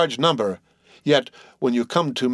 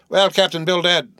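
interrupting; 7 semitones higher, 3 dB louder than usual. A narrator's reading. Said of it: "interrupted Peleg".

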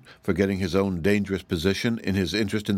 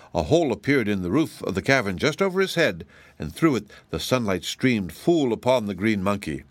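plain reading voice

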